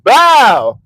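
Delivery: loud mock bang; impact sound effect. Comedian acting out hitting the gate, loud bang. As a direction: slam the word out like a crash.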